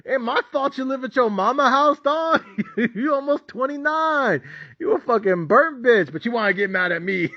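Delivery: high, mocking taunt with laughter breaking through; gleeful high-pitched ridicule. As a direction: cackle at him like he's beneath you. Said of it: "I'm like".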